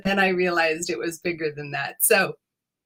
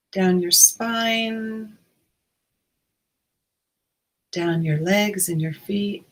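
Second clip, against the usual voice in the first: guided meditation. Acted. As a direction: ease listeners in, unhurried.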